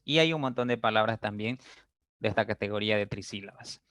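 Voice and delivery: normal talking voice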